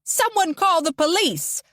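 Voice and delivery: high furious shout; angry shout. A narrator's reading. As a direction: demand the police in anger. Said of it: "continues to shout in anger".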